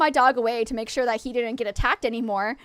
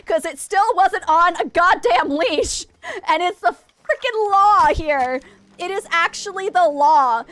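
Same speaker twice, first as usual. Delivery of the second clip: louder, slow outraged rant; angry rant; 6 semitones higher, 6 dB louder, slower than usual. Real, not acted. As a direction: hammer each word out in outrage. Ranting about unleashed dog.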